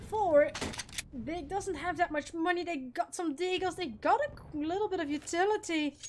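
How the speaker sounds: brisk esports caster calling the round; live play-by-play. Acted. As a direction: call the action as it happens. Casting round analysis.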